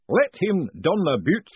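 slow, booming ritual master. A narrator's reading. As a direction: boom it out like a grand master of ceremonies. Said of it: "the voice went on".

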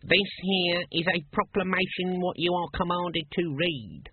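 high, officious announcement; loud proclaiming. A narrator's reading. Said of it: "said the guard loudly".

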